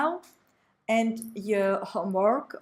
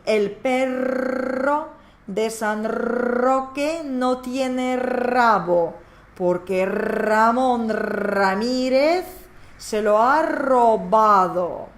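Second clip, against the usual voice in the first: slow and building louder toward the end; slow, syllable by syllable; 3 dB louder, slower than usual. Shown as a demonstration. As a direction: spell it out slowly, growing louder. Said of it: "Repeat after me".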